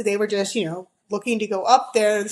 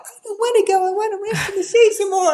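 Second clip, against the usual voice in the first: put-on higher, whining child's voice; whiny kid; 10 semitones higher than usual. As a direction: whine like a tired kid who wants to rest. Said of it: "I'm imagining this conversation".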